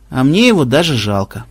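usual speaking voice

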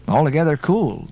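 plain reading voice

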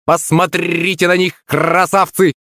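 high-pitched parrot squawk; screeching parrot. A narrator's reading. Parrot cheering on its team, high screech. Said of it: "заверещал попугай".

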